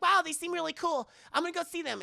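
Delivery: put-on high girl's voice, impressed; high-pitched acted wonder; imitated girl. Voicing the girl.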